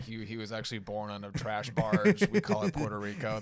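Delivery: a hint of a roast comic's jab; mock roast jab. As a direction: deliver it like a punchline. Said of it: "that would be funny if they're like".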